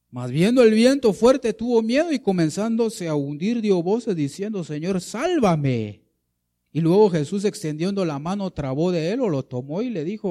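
loud, high, dramatic Bible reading; forceful scripture reading. A narrator's reading. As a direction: declaim the storm passage at full voice.

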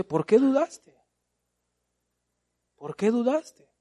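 high, loud echo of Jesus asking why; pleading Jesus. Retold or imitated.

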